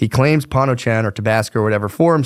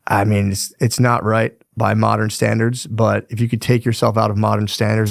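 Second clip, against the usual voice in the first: ordinary delivery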